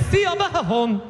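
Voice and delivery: "Thea Bahahon" read letter for letter, see-a-buh-huh-on; literal mispronunciation. Acted. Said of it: "it's pronounced Bah".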